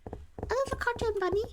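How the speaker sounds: high, soft squeaky bunny voice; cartoon bunny. Streamer echoing the remark, little cartoon bunny voice. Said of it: "like a little cartoon bunny".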